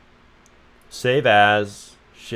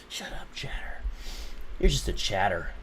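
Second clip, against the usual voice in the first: quiet taunting; 9 dB quieter than usual. Acted. Teasing viewer quietly.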